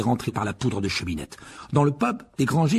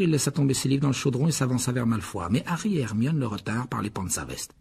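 ordinary delivery

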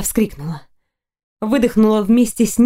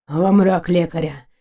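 lightly hoarse, lower male voice; slightly hoarse; 4 semitones lower than usual. A narrator's reading. Knight refuses a healer, low and slightly hoarse. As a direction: dismiss it low with a touch of rasp. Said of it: "чуть хрипло ответил он".

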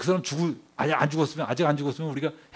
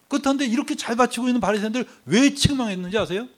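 loud, raised preacher's voice; impassioned sermon at high volume; forceful preaching; 6 semitones higher, 6 dB louder than usual. Real, not acted. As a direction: press the congregation with pointed, high-pitched questions. Preacher presses the congregation with questions.

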